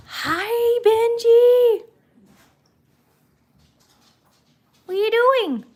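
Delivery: high squeaky pet voice. Real, not acted. Cooing to dog.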